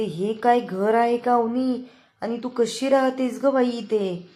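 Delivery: aghast at the poor house; dismayed disbelief. Retold or imitated. (घर पाहून धक्का बसलेला सूर)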